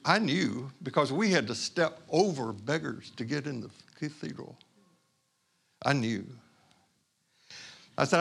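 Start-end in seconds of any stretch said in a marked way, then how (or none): none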